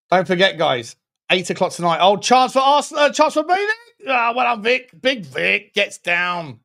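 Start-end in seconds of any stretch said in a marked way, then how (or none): 2.0-6.6 high, loud burst as the chance opens; louder and higher, rising with the attack; excited commentary. Real, not acted. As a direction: call the chance with real excitement. Chance in live match.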